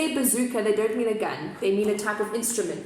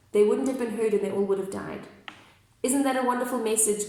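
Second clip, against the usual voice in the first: ordinary delivery, nothing marked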